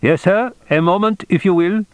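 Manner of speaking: put-on high-pitched, accented clerk; accented switchboard voice, pitched well up; brisk accented functionary. A narrator's reading. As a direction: stall the caller politely as an officious underling. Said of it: "accented brisk male functionary voice".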